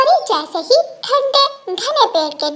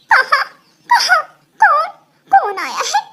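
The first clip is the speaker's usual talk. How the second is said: startled awake; 9 semitones higher than usual. Acted. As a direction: blurt it out, woken with a start. (नींद से चौंककर)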